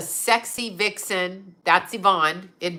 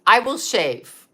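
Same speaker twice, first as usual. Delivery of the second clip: ordinary delivery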